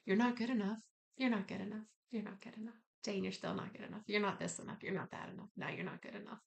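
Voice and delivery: quiet, nagging self-criticism under the breath; critical inner voice. Acted. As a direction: repeat it softly, fading almost to nothing. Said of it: "Sometimes my internal voice has told me".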